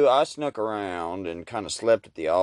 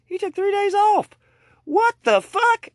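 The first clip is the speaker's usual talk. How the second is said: high loud incredulous cry; outraged disbelief; 7 dB louder than usual. Acted. Worker shocked the boss took days off, high and outraged. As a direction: squeal it in disbelief.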